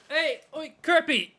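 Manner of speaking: high, loud hail in a put-on accent; loud accented call-out; shout in put-on accent. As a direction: holler to get someone's attention, accent on. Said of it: "No, I lost the accent".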